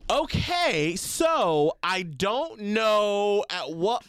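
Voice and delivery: imitated teacher treading carefully, drawn out; serious teacher. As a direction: spell it out slowly like an earnest sex-ed teacher. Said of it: "she was so serious".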